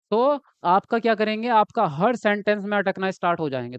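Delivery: normal talking voice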